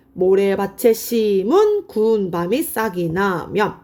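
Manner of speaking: slow, measured reading of a verse; drawn-out teacherly read-through; slow recitation. Shown as a demonstration. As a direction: spell the line out phrase by phrase. Reciting poem line while writing it up.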